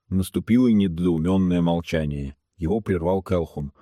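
plain reading voice

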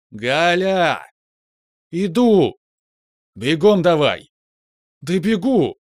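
high, loud calls back and forth; shouted calls. A narrator's reading. Colleagues shout to hurry down the stairs, loud. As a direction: yell across the stairwell.

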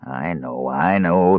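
weary, thin-voiced whining; thin whine. A narrator's reading. Jailer answering the bearskin man, whining thinly. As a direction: whine it thinly, put upon. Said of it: "the jailer whined in a thin voice".

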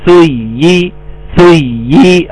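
"سي" slow, pressing on the doubled y. Shown as a demonstration. Teacher making the doubled letter clear, pressed ya. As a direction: lean on the ya in "سي" as suy-yi. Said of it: "عاوز أوضح الياء المشددة".

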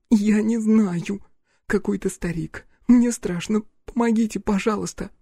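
put-on high-pitched scared girl; frightened pleading. Scared girl begs the man for help, frightened.

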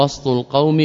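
وسط as "wast", no vowel after the s; وسط with a vowelless seen; silent middle consonant. Shown as a demonstration. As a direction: say وسط with s running straight into t. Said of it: "وسط بالسكون".

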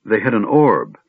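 ordinary delivery, nothing marked

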